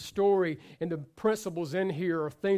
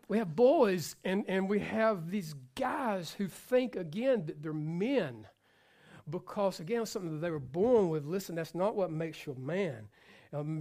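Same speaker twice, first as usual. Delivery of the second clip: usual speaking voice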